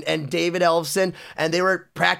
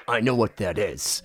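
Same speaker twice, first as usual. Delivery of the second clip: low, quiet imitation of the star; knowing rock star; 10 semitones lower, 4 dB quieter than usual. Star spots a kid's hidden Metallica shirt, low and knowing. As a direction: say it low, seeing right through it.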